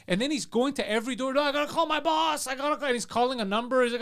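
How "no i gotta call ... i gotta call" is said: imitating panicked man. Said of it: "he's fucking panicking".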